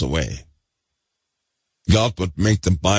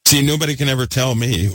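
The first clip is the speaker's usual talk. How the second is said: ordinary talk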